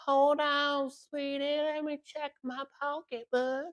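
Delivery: high sweet granny voice; old lady voice. Retold or imitated. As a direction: play a doddering old woman on the phone. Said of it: "he has a voice changer".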